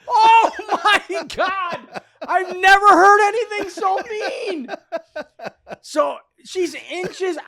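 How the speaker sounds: high, loud, laughing disbelief; shocked laughter. Real, not acted. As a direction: gasp it out through laughter. Laughing at the memory.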